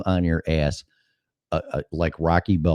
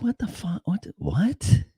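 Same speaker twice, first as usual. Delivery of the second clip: shocked disbelief; 13 semitones higher, 4 dB quieter than usual. Retold or imitated. Shocked by a dream memory.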